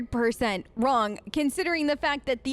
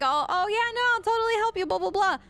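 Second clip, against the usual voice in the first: higher-pitched, breezy self-quote; mock eager agreement; 7 semitones higher, faster than usual. Retelling a fake deal to the demon, mock agreeable. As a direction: imitate your own empty promise. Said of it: "Like, I was like".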